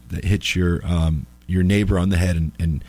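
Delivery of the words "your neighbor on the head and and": usual speaking voice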